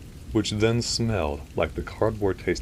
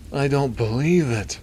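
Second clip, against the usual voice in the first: elongated gasp; 9 semitones higher, 5 dB louder than usual. A narrator's reading. Doctor seeing the footage, in an elongated gasp. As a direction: stretch it out as an astonished nasal gasp. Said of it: "said in a kind of elongated gasp".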